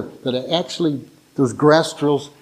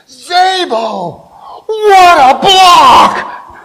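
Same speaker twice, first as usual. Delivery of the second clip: high, drawn-out holler, building louder; excited coach's shout; 10 dB louder, much slower than usual. Acted. Line coach leans over a player after his block, excited shout. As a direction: bellow it like a coach thrilled by a block.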